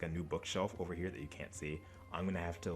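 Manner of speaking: usual speaking voice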